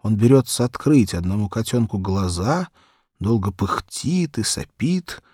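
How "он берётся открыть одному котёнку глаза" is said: usual speaking voice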